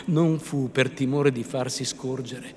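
ordinary delivery, nothing marked

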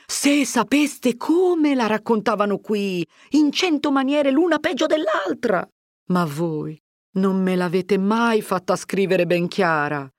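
lightly voiced village mother, glad; Agnese exclaiming. Agnese tells the rumours.

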